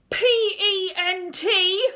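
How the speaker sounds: slow, high, loud summons; shout. A narrator's reading. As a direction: shout it from a high window, stretching each part. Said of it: "poked her head out and shouted".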